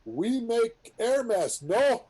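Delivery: high, slow, loud mock Saudi accent. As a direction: imitate a Saudi pilot, drawn out and high. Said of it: "In a Saudi voice".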